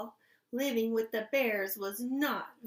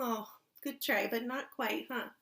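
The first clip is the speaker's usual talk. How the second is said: ordinary talk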